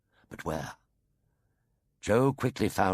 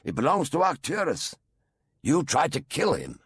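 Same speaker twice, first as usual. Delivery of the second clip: put-on higher, louder, indignant girl; exclaiming; 7 semitones higher, 3 dB louder than usual. A narrator's reading. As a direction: blurt it out in defiance. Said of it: "exclaimed Jo".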